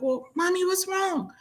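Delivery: a hint of a worried little kid; worried child. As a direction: mimic a child asking what's wrong. Kids asking their crying mother.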